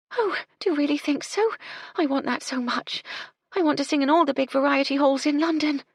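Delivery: put-on high, girlish and hopeful; eager young maid. Young housemaid tells Gertie of her stage dream, high and eager. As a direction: gush about her dream.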